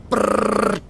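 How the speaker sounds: brrr gun noise with lips; imitated gunfire. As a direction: rattle off a mock machine gun.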